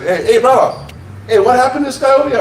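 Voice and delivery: high, quick local cop voice. Retold or imitated. Local cop questions the bouncer, high-pitched impersonation. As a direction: imitate a casual local cop calling over. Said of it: "local cop".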